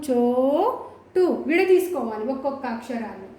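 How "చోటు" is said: very long "చో", then "టు" in "చోటు"; drawn-out long vowel. Shown as a demonstration. Teacher showing where it lengthens, "చో" held very long. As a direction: stretch "చో" slowly before "టు". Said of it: "ఎక్కడ దీర్ఘం తీస్తున్నాము".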